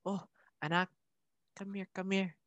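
ex's mom. Retold or imitated. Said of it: "my ex's mom was like".

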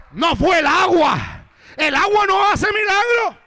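loud, high, drawn-out declaration; emphatic shouted sermon delivery; full-force preaching. Real, not acted. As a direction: proclaim it to the whole church at a shout.

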